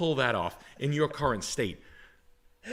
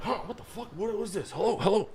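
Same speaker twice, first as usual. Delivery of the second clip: groggy dad waking up; 8 semitones higher than usual. Retold or imitated. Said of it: "he's literally waking up on speakerphone".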